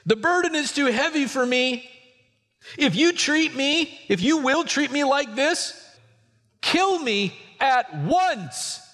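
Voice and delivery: high, pleading cry of a worn-out leader; despairing Moses. Acted. Moses crying to God, high and pleading. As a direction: beg God to end it, drawing out kill me at once.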